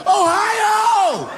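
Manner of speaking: very high, loud hometown yell; whooping Ohio callout; excited shout. Real, not acted. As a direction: hype the crowd up.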